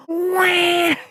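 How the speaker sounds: sudden high squeal of laughter; high laughing shriek. Real, not acted. Shriek at the burnout.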